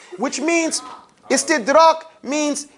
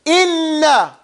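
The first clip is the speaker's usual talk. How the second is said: INNA pitched higher, double n held, then falling; emphatic; 4 semitones higher than usual. Shown as a demonstration. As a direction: press firmly into INNA for the class to copy. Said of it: "Go like this".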